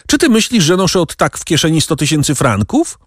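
high-pitched exasperated question; desperate. A narrator's reading. Captive protesting he carries no such sum, desperate. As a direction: demand it in a high, despairing voice. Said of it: "wrzasnął zrozpaczony Donglar".

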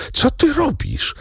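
high, startled shout of alarm; frightened cry. A narrator's reading. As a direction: cry out in sudden fear for her. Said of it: "krzyknął Zachojski, przestraszony". (przestraszony okrzyk)

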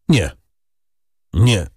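haltingly forced-out refusal; choked out. A narrator's reading. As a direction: force each word out separately, with pauses. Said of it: "wykrztusił pan de Villefort". (wykrztuszone z trudem)

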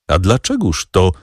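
ordinary delivery

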